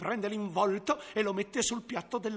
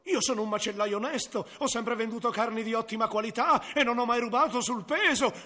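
hurried self-defence; 2 semitones higher, faster than usual. Acted. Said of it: "allora replicò".

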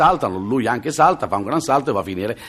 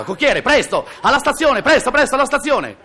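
urgent order; 13 semitones higher than usual. Acted. Hurried gentleman orders coachman.

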